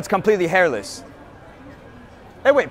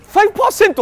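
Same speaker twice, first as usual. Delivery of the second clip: much higher and louder, fast Japanese shop greeting; excited salaryman; 11 semitones higher, 5 dB louder, much faster than usual. Acted. Salaryman hyping the discount, high and fast Japanese accent. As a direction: squeal like a thrilled Japanese shop clerk. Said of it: "read it like Salaryman Satoshi".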